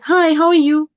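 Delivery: high, loud, breezy hi; casual greeting. Shown as a demonstration. Teacher mimics somebody who just says hi, casual and high. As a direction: throw out a greeting without wanting an answer. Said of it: "respond in the same tone".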